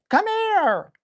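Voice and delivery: high, bright call to the dog; very high excited "Come here"; eager recall. Shown as a demonstration. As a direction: call him in like it's a party. Calling dog during run-away game.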